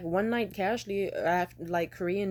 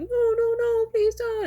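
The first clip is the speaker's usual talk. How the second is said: high squealed mock protest, much louder; mocking pleading girlfriend; 9 dB louder, slower than usual. Man mimicking his girlfriend's protest, high mock squeal. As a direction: squeak out a hammed-up no. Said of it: "he was even mocking her with a smile".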